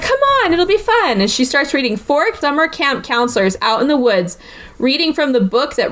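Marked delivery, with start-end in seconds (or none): 0.0-1.2 high-pitched playful pleading quote; mock wheedle, pitched way up; acted coaxing. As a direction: imitate a girl coaxing him along. Coaxing Frank in retold dialogue.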